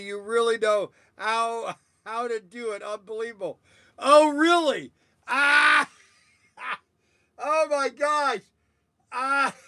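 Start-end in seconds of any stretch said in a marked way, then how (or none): 4.0-9.7 excited laughing outcry. Real, not acted. Host reacts to chat comment.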